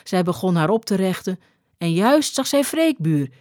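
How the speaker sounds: ordinary delivery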